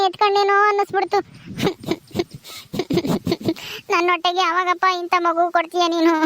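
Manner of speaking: tearful wail, sobbing between phrases; acted crying. Childless woman asking God for a child, acted sobbing lament. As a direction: lament to God, breaking into sobs. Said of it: "ಯಾಕ್ ಅಳ್ತಾ ಇದೀಯ".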